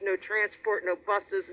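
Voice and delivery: ordinary delivery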